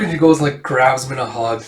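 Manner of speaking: usual speaking voice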